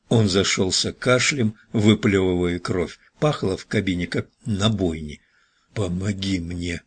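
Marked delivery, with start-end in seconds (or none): 5.7-6.8 soft, voiced near-whisper for help; whispered plea. A narrator's reading. Wounded Elton asks Richards for help, softly. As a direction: plead under your breath. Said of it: "прошептал он".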